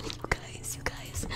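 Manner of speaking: very soft, voiceless whisper to viewers; stunned whisper. Real, not acted. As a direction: whisper it, overcome with disbelief. Speechless after finding diamonds.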